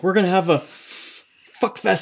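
halting, stammered high voice; stuttering. Retold or imitated. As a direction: stumble over the words. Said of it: "But he stutters".

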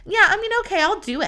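high, grudging mock yes; reluctant mock agreement. Mocking a reluctant friend who answers sure, grudging. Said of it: "sure to me gives the connotation".

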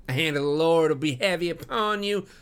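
high, loud righteous threat; cried-out curse. A narrator's reading. Departing suitor invoking the Lord against the farmer, crying out. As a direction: call down judgment on him. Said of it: "cried young Drebber".

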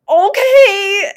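high, loud thrilled okay; excited okay. Retold or imitated. She answers her cheering friends, thrilled shout. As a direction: shout it out happily. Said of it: "And I was like".

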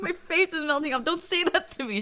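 high-pitched mock-embarrassed daughter; mortified pleading. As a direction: beg mom to stop, hammed up. Mortified daughter protests to her mother.

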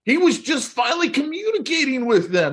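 high-pitched pleading defence; mock protest. Friend defending the yelling Russian dad, high mock protest.

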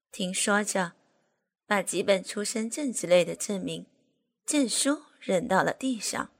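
usual speaking voice

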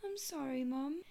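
put-on very soft, small kid voice; hushed little apology; apologetic child. Daughter to mom with a headache, very soft child voice. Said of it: "She's like".

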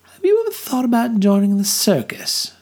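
Millie's voice. A narrator's reading. Millie voiced, far timbre.

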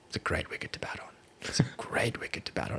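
hushed Imran Khan impression. Imran tipping off the Sri Lankan captain, in a whisper. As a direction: lean in and whisper the tip like a secret. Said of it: "I can't do an Imran Khan accent".

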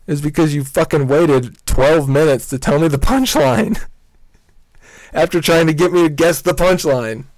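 high-pitched exasperated outburst with laughter; laughing exasperation. Real, not acted. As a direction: complain in disbelief, pitch climbing, laughing midway. Exasperated rant, breaking into laughter.

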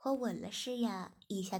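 ordinary delivery, nothing marked

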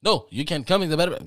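loud put-on clerk voice brushing him off; imitating the clerk. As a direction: ham up the motel clerk refusing. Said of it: "he was like".

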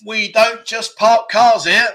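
slow, high, loud clue read; quiz clue announcement. Pretend. Host giving players clue number two, slow loud quizmaster delivery. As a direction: call it out with every word stretched.

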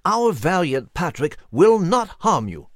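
lightly voiced character urging courage; exhorting. A narrator's reading. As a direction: reassure with a touch of pomp. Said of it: "Nigel exhorted the nervous eagle".

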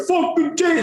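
high New York wiseguy yell; imitated New York tough-guy call; New York Sopranos voice. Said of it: "Sopranos kind of things".

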